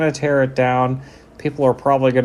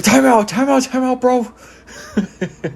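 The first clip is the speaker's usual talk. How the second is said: mock frantic coach calling timeout, much higher and louder; 12 semitones higher, 4 dB louder, much slower than usual. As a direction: squeal it like a panicking coach, laughing at the end. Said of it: "He's like".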